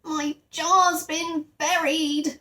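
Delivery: hotly protesting bear; hot indignation. A narrator's reading. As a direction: insist he's been wronged, drawing it out. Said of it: "exclaimed Paddington hotly".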